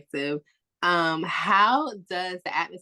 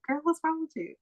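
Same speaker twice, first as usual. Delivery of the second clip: high, softer mock-puzzled husband; husband's retort; 9 semitones higher, 4 dB quieter than usual. Husband eyeing his dramatic wife, mock puzzled. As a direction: ask it baffled and amused. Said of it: "they just be looking at us like".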